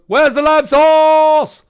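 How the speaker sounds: high, full-blast mock rage yell; eardrum-blowing slow shout; Gordon Ramsay freak-out. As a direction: bellow it high and loud, stretching the last word. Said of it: "I'm gonna blow your eardrums out".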